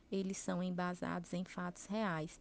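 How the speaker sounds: usual speaking voice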